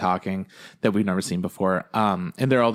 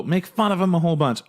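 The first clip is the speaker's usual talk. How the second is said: mock summary; 8 semitones higher than usual. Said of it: "repeated, higher".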